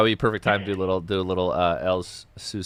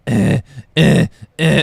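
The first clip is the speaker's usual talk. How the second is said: stupid voice; 5 semitones higher, 9 dB louder, much slower than usual. Retold or imitated. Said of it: "she does a really stupid".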